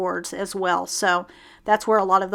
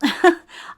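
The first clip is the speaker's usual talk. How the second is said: self-mocking laugh; 8 semitones higher, 4 dB louder than usual. Real, not acted. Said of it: "just a little laugh at myself".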